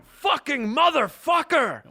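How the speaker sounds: drawn-out, high-pitched furious yell; retold scream. Imitating an angry passenger punching the plane window, screaming. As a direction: bellow it slowly, imitating a raging passenger.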